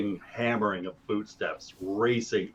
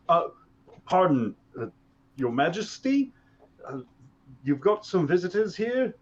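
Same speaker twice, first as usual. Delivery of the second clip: nervous soldier; 7 semitones higher than usual. Acted. Soldier addresses the king.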